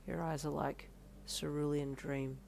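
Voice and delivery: soft, low, slow cheesy croon; low seductive line. Acted. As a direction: woo her with a corny low line. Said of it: "he uttered lowly".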